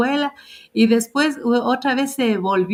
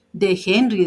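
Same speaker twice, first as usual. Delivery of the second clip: usual speaking voice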